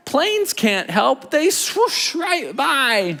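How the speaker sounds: very slow, high storytelling; slow dramatic reading. Acted. Teacher reads the rescue scene to kids, very slow and high. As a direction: stretch each word for suspense.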